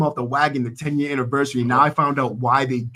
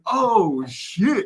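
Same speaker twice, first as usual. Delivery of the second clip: mock shock; 8 semitones higher than usual. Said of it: "I'm like".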